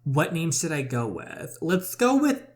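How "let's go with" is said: playful build-up. Real, not acted. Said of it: "choosing a fake name".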